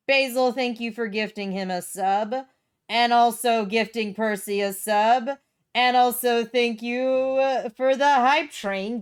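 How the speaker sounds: loud, drawn-out, building gratitude; excited thanks. Real, not acted. Streamer thanking viewers for hype train, excited.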